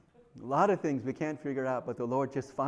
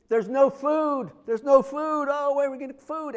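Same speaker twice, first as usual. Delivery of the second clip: higher, louder, panicky complaining; mock panicked shout; 9 semitones higher, 4 dB louder than usual. Israelites cry to Moses about no food, higher and louder in mock panic. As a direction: wail that there's nothing to eat.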